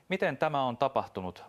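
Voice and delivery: barely holding in his cold rage, with MITEN pushed up high; suppressed cold anger. Acted. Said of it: "mutisi taltuttaen kylmää vihaansa". (hillitty kylmä viha)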